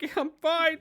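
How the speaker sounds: high, loud, crying voice; mock sobbing. Friend telling his wife he's fine, mock sobbing. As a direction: act out choking back tears at the movie. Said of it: "practically sobbing".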